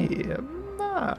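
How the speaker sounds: very high cartoon Mario squeak; squeaky Italian plumber voice. Echoing Mario catchphrase.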